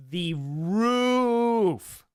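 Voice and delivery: roof drawn out loud and high, long ruuf; Midwest roof. Shown as a demonstration. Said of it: "not roof".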